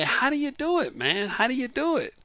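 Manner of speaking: men asking a married man, high and amazed; curious men asking. Retold or imitated. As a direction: ask it like an amazed younger guy.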